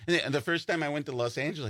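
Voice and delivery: ordinary talk, nothing marked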